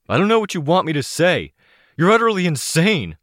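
strained, high and loud, fast; holding back a shout. Acted. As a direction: force it out through the teeth. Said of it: "he hissed through teeth".

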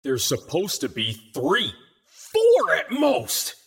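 starts low, bursts up on a drawn-out finish; unearthly roar. A narrator's reading. Team leader rages about how many enemies were expected, rising unearthly roar. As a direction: build from deep growl to angry roar. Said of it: "roared in his unearthly voice".

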